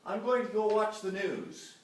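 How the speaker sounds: high, loud comic cartoon voice; cartoon character. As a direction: read the cartoon caption as a bright caricature. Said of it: "the little cartoon is saying".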